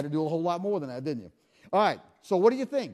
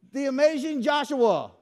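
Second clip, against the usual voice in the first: showman announcement; 6 semitones higher, 4 dB louder than usual. Acted. Introducing volunteer showman style.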